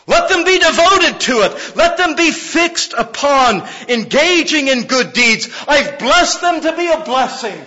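high, loud, driving sermon voice; preaching at full force. Acted. Pastor preaching grace to church, loud and high. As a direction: preach it out with fire to the congregation.

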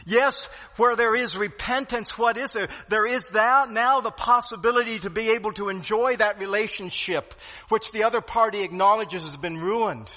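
loud, high, driving sermon; fervent preaching. Acted. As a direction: preach it with full force. Preaching at full force.

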